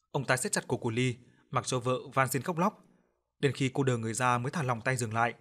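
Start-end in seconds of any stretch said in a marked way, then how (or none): none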